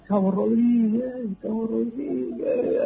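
high, slow drawn-out call to the corporal from underground; calling for help. Retold or imitated. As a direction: call out the name, stretched and high, hoping to be heard. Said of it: "gritábamos". (llamado agudo y lento pidiendo auxilio)

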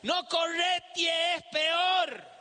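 high, drawn-out warning cry; hammed-up old lady's high holler; shouting old neighbor woman. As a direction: call out like an old street sweeper. Old women shout warning in street.

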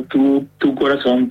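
usual speaking voice

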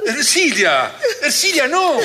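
high, alarmed cry of a name; alarma. Acted. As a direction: call out in rising panic. Woman sees her companion fainting. (grito agudo de alarma)

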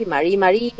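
"mari, mari" accented on the last i; stress on final I. Shown as a demonstration. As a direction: push the final i in each "mari, mari". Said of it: "hacen una acentuación en la I".

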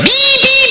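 very high imitated beeping; high electronic pi pi beeps; car warning beeps. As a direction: beep like a dashboard alarm. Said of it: "otra vez".

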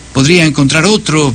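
usual speaking voice

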